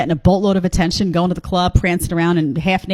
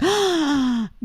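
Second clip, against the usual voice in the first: high, sharp mock gasp of outrage; mock shocked gasp; 8 semitones higher, 4 dB quieter than usual. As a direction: gasp like the offended women. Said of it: "all the women now are gonna be like".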